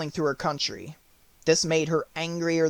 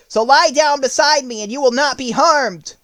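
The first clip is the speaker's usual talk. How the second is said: bold Scarecrow; 8 semitones higher, 6 dB louder than usual. Acted. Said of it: "the Scarecrow said".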